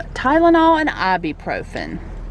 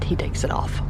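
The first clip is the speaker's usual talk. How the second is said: whisper; 5 dB quieter than usual. Real, not acted. Aside whispered to camera.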